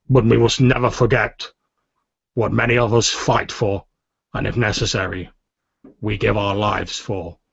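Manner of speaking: measured oratory with emphatic swells; rousing, solemn commander. Acted. Commander addresses the rebels after Cade's death, solemn and rousing. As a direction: deliver a grave eulogy that builds into a rallying call.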